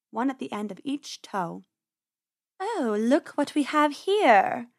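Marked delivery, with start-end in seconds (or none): 2.6-4.7 slow, purring cat voice; a sly cat savoring her prey; lazy purr of a hungry cat. Acted. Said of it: "she purred".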